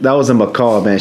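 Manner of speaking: ordinary talk, nothing marked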